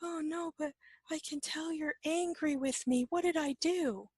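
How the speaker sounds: high-pitched imitation of an anxious friend; worried companion. Companion insists you are angry with them, high anxious mimicry. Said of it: "And the person goes".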